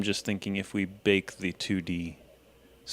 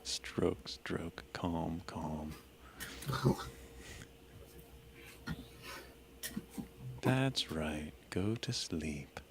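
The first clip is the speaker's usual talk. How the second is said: soothing hush; 3 semitones lower, 12 dB quieter, much slower than usual. Acted. Lulling charmed creature to sleep.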